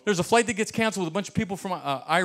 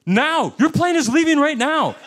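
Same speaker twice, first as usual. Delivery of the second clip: high loud urgent cry; urgent shout; 11 semitones higher, 7 dB louder than usual. Retold or imitated. Gate agent telling him his plane is leaving, loud urgent shout. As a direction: yell it like the doors are closing.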